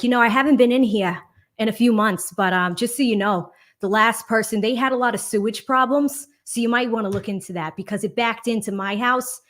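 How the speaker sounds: chatty Boston townie neighbor; Boston accent. Retold or imitated. Nosy neighbor quizzing the new homeowner, Boston accent. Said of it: "best example of a Boston accent".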